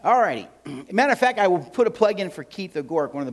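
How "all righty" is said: normal talking voice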